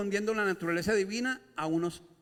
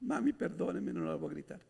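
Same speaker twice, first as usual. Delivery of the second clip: hammed-up guilty child; imitating a repentant son; mock remorse; 4 dB quieter than usual. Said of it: "La hice llorar".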